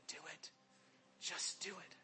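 a quiet inner voice urging him on; soft inner nudge. Retold or imitated. Holy Spirit nudging his heart.

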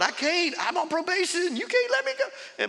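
mock panic, very high pitched; panicked squeak. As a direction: voice leaps up in frantic protest. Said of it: "In my heart, I'm going".

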